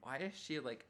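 usual speaking voice

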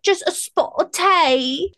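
high, slow mock-British alter ego; English accent. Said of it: "English alter ego and she was being her".

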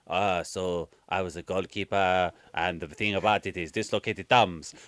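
slow, low, plodding mimicry; mocked film heroine. Imagining the woman watching the heroine, mocking slow speech. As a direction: drone it out as a mock monologue. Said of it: "watching this woman go".

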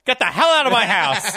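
hammed-up furious dad; mock angry dad yell. Dad shouting at his kid.

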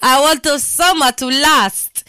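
mock African accent crooned over the track; bad African accent. Radio host jokingly sings along to listeners in a bad African accent. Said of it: "That was a really bad African accent".

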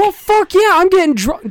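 high rowdy party voice; getting drunker. Acted. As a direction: hype it up like a drunk. Hyped banter about drinking.

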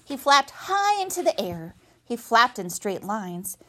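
usual speaking voice

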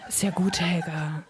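hushed whispered comfort; whispering to calm the weeping cook; soothing whisper. A narrator's reading. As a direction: shush her like a crying child. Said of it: "fängt sie tröstend auf".

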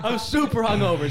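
sheepish apology, still cracking up; laughing. Real, not acted. Co-host excuses his spit-take to the group, laughing.

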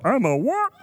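high, incredulous squeak; surprised Gideon. Acted. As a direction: Gideon can't believe it. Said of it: "Gideon surprised".

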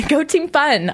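high laughing cheer; cheerful cheer. Acted. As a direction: echo the slogan with a laugh. Echoing the catchphrase.

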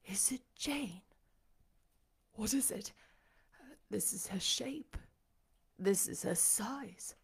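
hushed, breath-held wonder, with long pauses; awed disbelief. A narrator's reading. Blind master recognising Jane by touch, in hushed awe. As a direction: softly test each discovery aloud.

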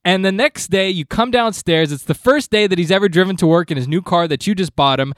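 normal talking voice

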